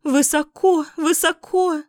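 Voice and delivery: hens clucking. A narrator's reading. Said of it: "кокали куры".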